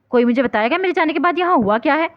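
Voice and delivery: father shouting to know what happened; angry shout. Acted. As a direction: shout at the whole room. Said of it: "حسن غصے سے چلائے تھے".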